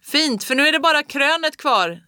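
loud rallying shout. A narrator's reading. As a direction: shout him on to the crest. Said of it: "skrek pappa".

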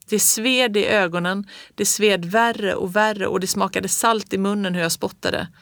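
usual speaking voice

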